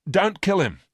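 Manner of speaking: a sharp, high cry to stop the shot; sudden outburst. A narrator's reading. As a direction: jump in fast to stop him. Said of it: "said Miranda suddenly".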